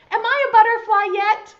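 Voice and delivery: high, loud, quick question; impatient outburst. Acted. Caterpillar pesters friend about being a butterfly, loud and impatient. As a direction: blurt it out, unable to wait.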